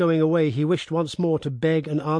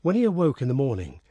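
ordinary delivery, nothing marked

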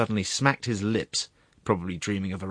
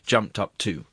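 ordinary delivery, nothing marked

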